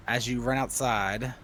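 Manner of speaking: ordinary delivery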